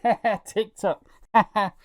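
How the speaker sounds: put-on high, giggly airheaded teen voice; dumb keen teen. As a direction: play a brainless TikTok kid laughing. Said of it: "stupid keen teen".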